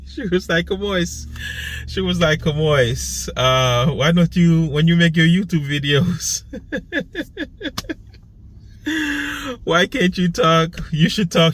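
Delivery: high-pitched, giggly retelling; laughing retelling. Real, not acted. Vlogger quoting her friend about the accent, laughing. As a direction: quote a friend while breaking into laughs.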